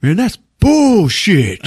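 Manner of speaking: loud, high, slow laughing jeer; mock prisoner jeer. Prisoners mocking the warden over the books, loud high jeer. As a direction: drag it out like a scoffing inmate. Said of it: "you hear the prisoners go like".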